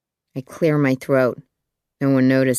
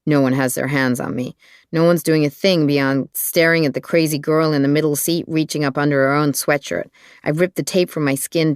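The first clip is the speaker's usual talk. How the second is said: ordinary delivery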